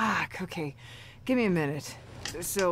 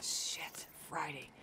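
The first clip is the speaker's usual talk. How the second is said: dismayed whisper; 6 semitones lower, 13 dB quieter, slower than usual. Acted. Realising, dismayed, under breath.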